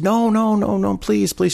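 high, sing-out string of polite refusals; insistent polite protest. Retold or imitated. Server begs apologetic guest not to rush, politely insistent. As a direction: jump up high and wave the offer away.

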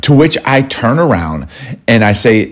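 ordinary talk, nothing marked

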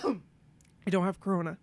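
hoarse after water went down the wrong way; coughing up water. Real, not acted. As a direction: voice strained after choking. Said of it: "Water went down the lo-, wrong way".

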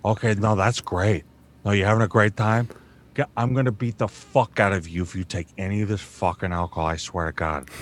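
low, soft East Coast tough guy threatening; low rough tough-guy threat. Shown as a demonstration. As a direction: menace them quietly. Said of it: "there's the voice".

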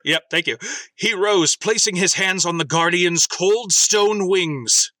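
lightly dramatic story reading; story narration. Acted. Host reading the AI story to co-hosts, lightly dramatic narration. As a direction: read it like a faintly solemn fan fiction.

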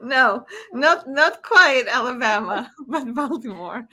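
laughing the words out amused. Real, not acted. Guest laughs correcting the host about Alabama, laughing.

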